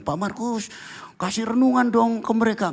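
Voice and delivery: high, coaxing request; friends asking. Retold or imitated. Friends coaxing Pastor Markus to preach, high. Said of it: "Teman-teman bilang".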